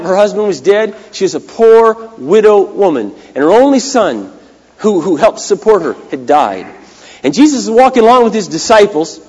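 ordinary delivery

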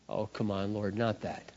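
low, soft grumbling plea; reluctant mock plea. Man pleading with the Lord to be let off, reluctant. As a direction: complain under your breath, lightly acted.

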